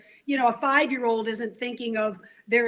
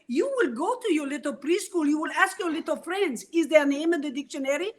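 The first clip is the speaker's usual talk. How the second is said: put-on proud father in a light Arabic accent, louder toward the end; thick Arabic accent; 2 semitones higher, 4 dB louder than usual. Father tells his daughter her name is special, Arabic accent. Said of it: "in his thick accent".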